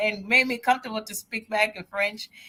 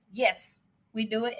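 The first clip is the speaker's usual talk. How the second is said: ordinary talk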